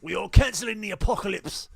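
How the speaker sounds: loud rousing movie-hero shout; movie speech shout. Pretend. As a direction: belt it out like a commander rallying troops. Quoting Pacific Rim speech.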